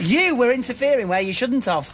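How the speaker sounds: high-pitched protest; indignant accusation. Acted. Accusing back in an acted row.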